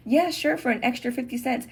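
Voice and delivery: high-pitched mock-agreeable server; restaurant worker reply. Server answering a customer asking for sauces, high mock-agreeable voice.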